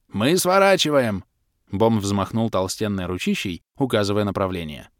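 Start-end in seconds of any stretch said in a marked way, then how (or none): 0.1-1.2 high, loud booming half-orc over the wind; big friendly brute shouting cheerfully; hearty half-orc. A narrator's reading. Bom shouting direction. (полуорк Бом добродушно орёт на ходу)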